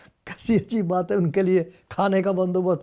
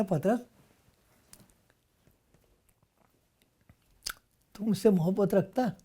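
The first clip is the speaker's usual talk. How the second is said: tender question; 6 dB quieter than usual. Retold or imitated. Jesus asks Peter tenderly.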